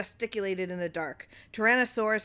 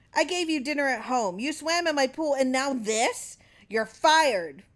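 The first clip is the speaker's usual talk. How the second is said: high, loud, building rage; furious firing; 7 semitones higher, 6 dB louder than usual. Acted. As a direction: pile up the grievances, then fire him. Clarence firing Terwilliger.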